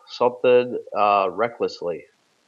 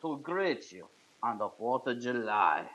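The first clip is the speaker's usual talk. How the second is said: put-on Russian accent broadcast to the American pilots; mock Russian accent; 4 semitones higher than usual. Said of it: "We are here from Mother Russia".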